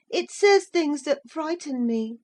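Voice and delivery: put-on high timid boy voice; a touch of a frightened child; scared boy. A narrator's reading. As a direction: confess the fear.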